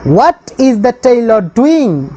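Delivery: very slow, each word drawn out for the class; slow dictation. Shown as a demonstration. Teacher reads the English question out to the class very slowly. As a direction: spell it out slowly so pupils can write. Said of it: "question में क्या बोले?".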